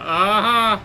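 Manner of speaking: loud, high, triumphant aha; excited exclamation. Real, not acted. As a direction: cry out as the thrill hits. Reacting loudly to the ride.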